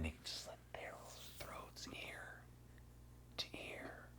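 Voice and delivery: slow, voiceless whisper; hushed whisper. Real, not acted. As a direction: drop to a whisper for the gory bit. Describing throat slit in scene.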